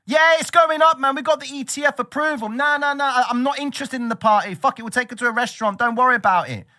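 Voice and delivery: high, loud excited brag; hyped trader. Retold or imitated. Trader telling his partner Bitcoin is rising, high hyped voice. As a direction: gush about the price going up.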